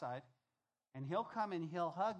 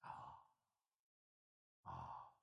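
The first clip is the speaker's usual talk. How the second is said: whisper; 11 dB quieter than usual. Retold or imitated. Savoring remembered good fruit.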